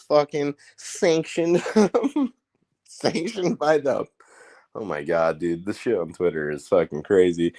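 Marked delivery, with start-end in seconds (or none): none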